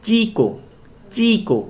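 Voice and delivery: Chikoo with a high, drawn ची and falling को; stress on first syllable. Shown as a demonstration. Teacher repeats the example, stressing ची in chikoo. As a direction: pitch the ची of chikoo right up. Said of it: "यहाँ पे ची पे stress होगा".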